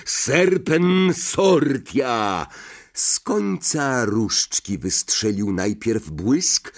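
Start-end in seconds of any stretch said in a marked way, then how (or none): 0.1-2.5 higher, drawn-out incantation; roared spell. A narrator's reading. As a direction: cry out the spell slowly and forcefully. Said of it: "ryknął".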